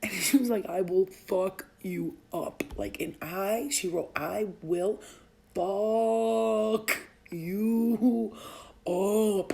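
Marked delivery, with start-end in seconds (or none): 0.7-2.6 slow, deliberate word-by-word readout; line by line. Retold or imitated. Mother quoting her daughter's text, line by line. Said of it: "she wrote it perfectly, line by line". 4.2-9.5 very slow, every word stretched out; line by line. Retold or imitated. Mother showing how her daughter wrote it, line by line. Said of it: "she wrote it perfectly, line by line".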